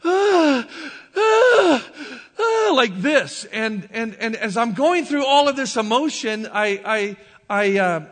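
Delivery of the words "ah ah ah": loud high drawn-out moan ahs. Retold or imitated. Man reliving his angel vision, moaning aloud. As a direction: moan out each ah, overwhelmed. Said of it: "I'm kinda almost moaning".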